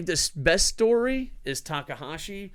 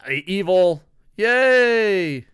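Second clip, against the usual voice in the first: high, loud sarcastic cheer; hammed-up yay; mock cheer; 5 semitones higher, 8 dB louder, much slower than usual. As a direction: cheer it like a fake fan. Mock cheer for villain win.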